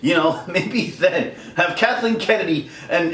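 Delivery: usual speaking voice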